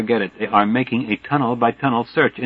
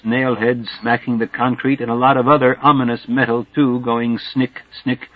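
plain reading voice

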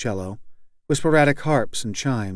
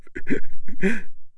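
shuddering breaths; 8 semitones higher, 5 dB louder than usual. A narrator's reading. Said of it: "drawing shuddering breaths".

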